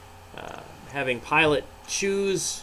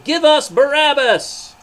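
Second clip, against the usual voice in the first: loud, much higher, slow mob shout; crowd shouting; 13 semitones higher, 11 dB louder, slower than usual. Acted. Crowd answering Pilate, loud slow shout. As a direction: bellow it as a whole crowd. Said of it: "Immediately the people shouted".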